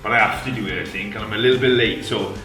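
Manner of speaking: upbeat presenter intro. Acted. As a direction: announce it like a show host. Recorded intro to camera.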